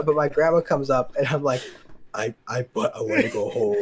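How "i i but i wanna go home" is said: mock tearful whimper, half laughing; about to cry. Sick kid telling his grandma he wants home, mock tearful. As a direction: play at nearly crying to be taken home. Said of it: "I was, like, about to cry".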